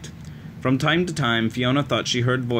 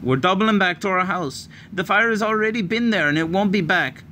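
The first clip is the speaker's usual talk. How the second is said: urgent answer; 8 semitones higher than usual. A narrator's reading. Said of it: "Fiona answered urgently".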